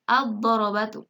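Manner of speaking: "ad-darabatu" with a heavy doubled D; silent lam. Shown as a demonstration. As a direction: read "ad-darabatu" with no L, lam merged into dad. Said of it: "alif lamnya tidak dibaca jelas".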